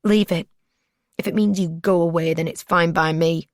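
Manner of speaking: defiant, loud retort; angry young woman snapping back. A narrator's reading. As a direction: spit it back at him in anger. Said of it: "She was angry".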